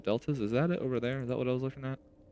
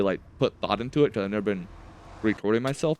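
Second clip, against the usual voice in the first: usual speaking voice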